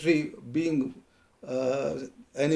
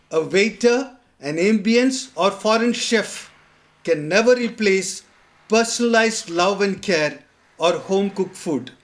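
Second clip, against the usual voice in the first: measured, declaimed poetry reading with pauses between phrases; poem recitation; 4 semitones higher, 4 dB louder than usual. Acted. Poet reciting a Christmas dinner poem to poet friends, declaimed recitation. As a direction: recite it line by line, with deliberate emphasis.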